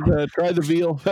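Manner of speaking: loud, high showman's sign-off; lounge comedian. Pretend. Podcast host mock-signing off after a pun, hammy lounge comedian. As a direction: work the room like a club comic.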